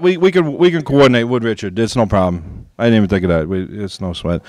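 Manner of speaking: ordinary talk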